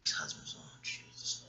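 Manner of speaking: hushed unvoiced whisper under her breath; whispered aside. Real, not acted. Gossiping to viewers in a whisper. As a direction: drop to a secret whisper. Aside about the other husbands.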